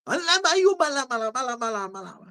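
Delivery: tongues fading away. Retold or imitated. Preacher answering his son in tongues, fading away. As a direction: let the babble fade to nothing. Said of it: "Aí eu fiz assim pra ele".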